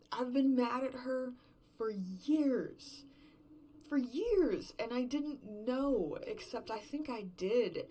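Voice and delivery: loud and high, voice rising in rage; angry shout. Acted. Woman raging about the partner she resented for years, shouting. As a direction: let years of resentment burst out.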